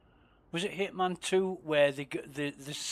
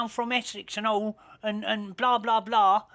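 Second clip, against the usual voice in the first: higher mock Essex voice, getting louder; mock Essex accent; 7 semitones higher, 6 dB louder than usual. Mocking the game's voice actress, hammed-up Essex accent. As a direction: mimic the Hitman voice actress in Essex. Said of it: "she's talking like this".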